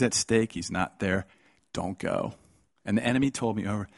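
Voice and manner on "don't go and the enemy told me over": ordinary talk, nothing marked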